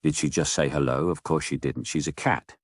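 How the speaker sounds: ordinary delivery, nothing marked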